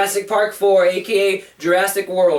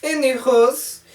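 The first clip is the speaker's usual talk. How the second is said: ordinary delivery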